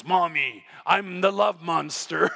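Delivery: low, slow mock monster voice; playful monster. Muddy girl announcing herself to mom, mock-monstrous. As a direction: play a little kid being a monster.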